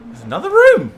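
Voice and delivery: high loud excited cry; excited shout. Real, not acted. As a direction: burst out in delight. Finds a room in the game.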